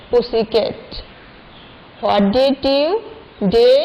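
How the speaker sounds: slow sing-song chant, rising then falling; teacher reciting the nursery rhyme to pupils; reciting rhyme to children. Shown as a demonstration. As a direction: chant each word slowly for the class. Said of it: "আমি আবার পড়িয়ে দিচ্ছি তোমাদেরকে".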